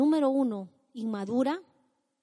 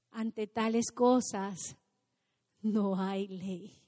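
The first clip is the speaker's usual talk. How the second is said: lento y marcado; 3 semitones lower, much slower than usual. Acted.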